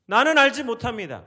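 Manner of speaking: loud, high-pitched disclaimer; acted-out protest to God; defiant denial. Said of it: "하나님 물어보실 때 뭐라고 말합니까".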